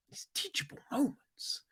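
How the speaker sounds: high whisper; whispered aside. Real, not acted. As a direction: say it on the side, a little wry.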